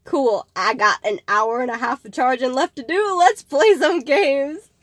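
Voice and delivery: building to a high, loud cheer; hammed-up enthusiasm, rising and slowing; mock eager reply. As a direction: play up the excitement, stretching out the end. Acting out her eager reply.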